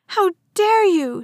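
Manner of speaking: high, loud scream of outrage; girl shouting at her father in fury; furious shout. A narrator's reading. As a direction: shout it at him, enraged. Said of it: "she shouted".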